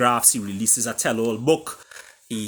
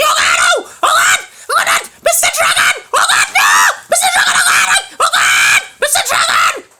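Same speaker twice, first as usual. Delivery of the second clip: shrieking falsetto pleas, far higher and louder, half laughing; soprano begging; 11 dB louder than usual. Acted. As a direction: beg for mercy in a squealing soprano. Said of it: "soprano voice of Tiger Cat begging for mercy".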